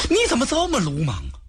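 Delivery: 语速加快的训斥，略微拔高; 一股急切的责备，微带怒气; scolding anger. Acted. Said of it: "曹操一看可生了气了". (曹操责骂许褚鲁莽，语速加快)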